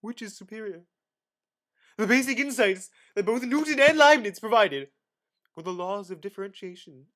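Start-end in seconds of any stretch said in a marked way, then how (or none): 2.0-4.9 loud emphasis. Acted. Dramatic reading of history.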